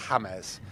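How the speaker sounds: "[name]" said Spanish-style as HA-mez; Spanish-style name. Retold or imitated. Man repeats his friend's nickname for him, Spanish-style "[name]". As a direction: say "[name]" with an h and two syllables, HA-mez.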